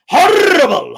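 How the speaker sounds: big, loud, emphatic outburst; loud disgusted verdict. Real, not acted. Streamer judging Tyson Fury's performance, loud disgusted outburst. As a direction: belt it out in disgust.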